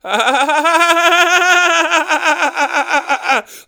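long, high, loud fake laugh; hammed-up staccato laugh that keeps going; Jason Bateman laugh. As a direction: force out a machine-gun cackle on one high note. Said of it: "I'm laughing like fucking Jason Bateman in that one episode".

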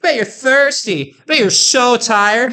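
drawn-out sing-song gloating; mocking taunt. Teasing exhausted cyclists while chugging water, slow and high. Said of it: "just to mock people".

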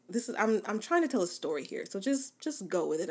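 ordinary talk, nothing marked

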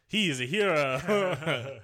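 slow, loud mock praise through a laugh; laughing, hammed-up sarcasm; sarcastic. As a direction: praise him like you mean the opposite. Mocking the sheriff.

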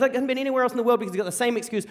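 ordinary talk

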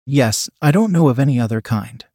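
ordinary delivery

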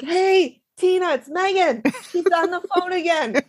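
high, bright mock dispatcher. Imitating a dispatcher telling a coworker, high-pitched. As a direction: play the weary dispatcher up high. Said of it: "she's like".

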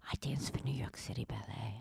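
breathy secretive whisper; mock humblebrag whisper. Mocking people who whisper their fancy jobs.

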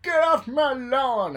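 loud, high, slow mock yell; hammed-up cranky geezer; grumpy old man. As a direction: shout at the kids on your lawn.

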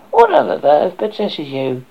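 loud, slow scolding hen; fussy hen voice. Acted. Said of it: "she asked".

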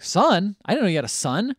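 loud, high startled question; mock disbelief. Woman learns Troy has a son, mock disbelief. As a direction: act out being taken aback. Acted startled reply in quarrel.

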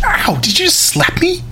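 high-pitched shocked protest; indignant yelp. Acted. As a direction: squeal in disbelief after a slap. Host has just been slapped.

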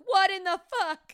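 high, loud, slow disbelief; stunned disbelief. Retold or imitated. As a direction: gasp it out in shock. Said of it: "We're like".

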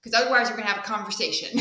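normal talking voice

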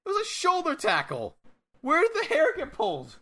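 high-pitched mock commentator protest; incredulous commentator. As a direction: squeak out a commentator's disbelief. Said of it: "Commentary's like".

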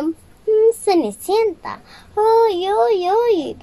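dulzura falsa y aguda; sonrisa fingida. Acted. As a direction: fingir simpatía muy agudo. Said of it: "Tratando de hacer la sonrisa". (la bruja finge sonreír a Cenicienta, voz aguda y empalagosa)

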